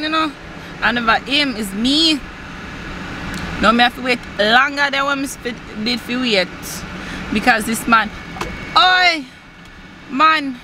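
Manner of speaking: light mock Jamaican patois rant; mock Jamaican accent. Vlogger retelling a taxi queue jumper, mock Jamaican accent. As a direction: complain about the cut-in in a Jamaican accent.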